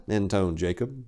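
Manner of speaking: plain reading voice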